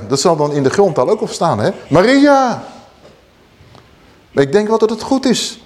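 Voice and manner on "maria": ringing exclamation; exclaimed name. Acted. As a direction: wake her with her name. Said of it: "Er staat een uitroepteken bij".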